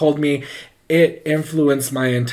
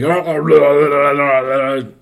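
loud garbled possessed bellowing; imitated beastly roaring babble; bear-like yelling; 6 dB louder, slower than usual. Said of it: "she sounded like a bear".

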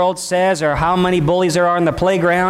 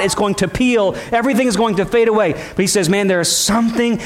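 higher, driving sermon delivery; fervent preaching; 5 semitones higher than usual. Acted. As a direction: preach it with urgent conviction, pitch lifted. Preaches with rising fervor.

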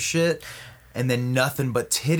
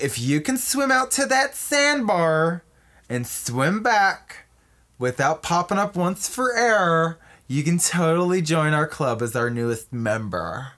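high, slow, campy queen bee; Kevin Costner; 4 semitones higher than usual. Acted. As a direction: lay down the challenge in drawn-out ditzy tones. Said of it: "that's Kevin Costner talking".

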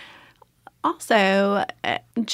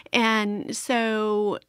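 usual speaking voice

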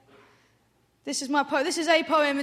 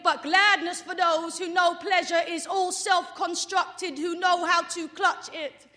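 rhythmic slam delivery, higher and louder than chat; spoken word poetry; 6 semitones higher, 5 dB louder, slower than usual. Acted. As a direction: drive the verse forward on its rhymes. Performs her spoken word poem.

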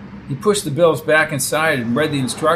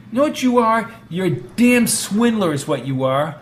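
angry; 6 semitones higher, 4 dB louder than usual. Acted. Brady scolding.

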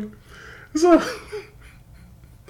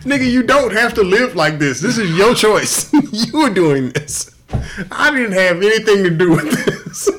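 voice of the Lord; 8 semitones higher, 4 dB louder than usual. Pretend. Acting out the Lord answering him, higher and louder mock sermon. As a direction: play God lecturing him, half laughing. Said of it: "The Lord looked back at you".

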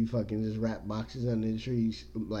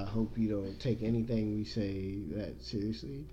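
ordinary delivery

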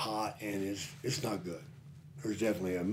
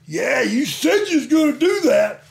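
full-throated yell, pitched way up; shouting; 15 dB louder than usual. Retold or imitated. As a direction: yell it at the top of your lungs. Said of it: "I'm like".

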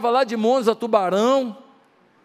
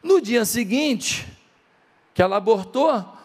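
usual speaking voice

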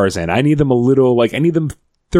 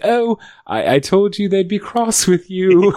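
put-on modern British accent; 8 semitones higher, slower than usual. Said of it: "And then she's like".

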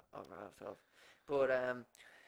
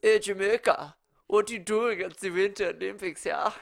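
raised, hammed-up German villain accent; bad fake German; 5 semitones higher, 4 dB louder than usual. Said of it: "American dudes pretend to be Germans".

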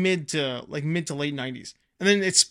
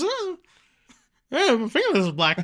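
a light take on the dazed character, pitched up; movie character voice; 9 semitones higher than usual. Retold or imitated. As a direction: quote it as the dazed guy. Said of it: "he sees his fingernails".

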